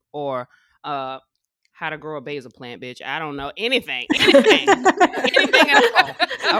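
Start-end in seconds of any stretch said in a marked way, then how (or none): 4.1-4.7 squeaky high playful repeat; mock high squeal. Host teasing guest seekers, high playful squeal. 4.7-6.6 high, slow, laughing stretch; laughing squeal. Pretend. Host joking with podcast listeners, laughing high and slow.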